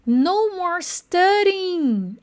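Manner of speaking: "studying" slowed right down, t flapped; slow pronunciation model. Shown as a demonstration. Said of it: "olha que legal a pronúncia". (bem devagar, marcando a pronúncia)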